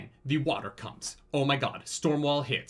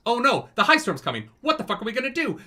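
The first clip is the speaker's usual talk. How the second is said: high and louder, urgent oh-no; mock panic; 9 semitones higher, 4 dB louder than usual. Retelling the high storm in mock panic. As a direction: ham up the alarm.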